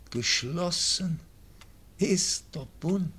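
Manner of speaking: high, loud, rising disbelief; astonished echo. Acted. Dying baron echoes the news of the pact, high and astonished. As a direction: seize the news with sudden strength.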